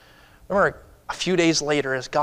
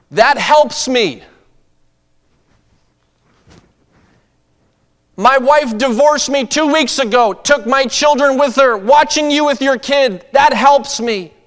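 high, loud, slow pleading cry; a broken man calling out his pain; desperate stranger; 11 semitones higher, 11 dB louder than usual. Retold or imitated. Desperate stranger's plea retold.

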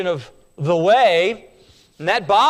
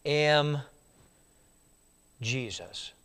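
low, soft, solemn voice; voice of Jesus; 5 semitones lower, 6 dB quieter than usual. Acted. Jesus answering Saul, lower and much softer. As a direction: speak it low and hushed. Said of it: "And the Lord said".

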